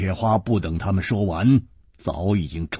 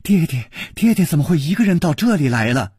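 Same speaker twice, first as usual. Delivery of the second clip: higher, quick, happy question; smiling, delighted; 10 semitones higher, 4 dB louder, faster than usual. A narrator's reading. Princess in her father's arms, smiling and quick. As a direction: ask it quickly through a smile. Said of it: "笑着".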